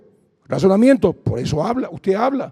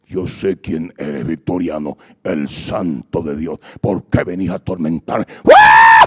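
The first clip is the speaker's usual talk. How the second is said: low, slow demonic growl; 6 dB louder, slower than usual. Acted. Demon recognizing the preacher, low growl.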